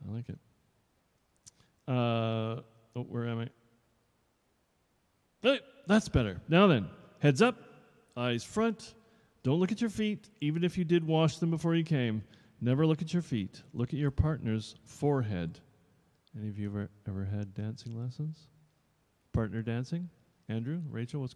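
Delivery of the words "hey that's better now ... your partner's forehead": louder, a touch higher stern teacher; strict dance teacher. Acted. Dance teacher addressing new pupils, mildly stern. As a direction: lay down the rules.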